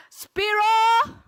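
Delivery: very loud, high, drawn-out cheer; full-energy rallying shout. Shown as a demonstration. Speaker urging a well-fed audience to answer, full-energy rallying shout. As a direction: belt it out to fire up the room. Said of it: "please say it with more energy".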